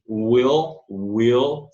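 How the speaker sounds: "will" ending in a guttural dark L. Shown as a demonstration. Teacher demonstrates the dark L to learners, guttural L demo. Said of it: "aquele L gutural".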